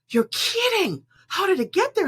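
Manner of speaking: high startled disbelief from the pool cleaner; shocked pool man. Retold or imitated. Pool cleaner hearing of a drowned tarantula, shocked high voice. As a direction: squeak it out in shock.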